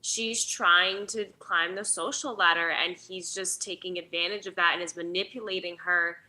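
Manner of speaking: ordinary talk, nothing marked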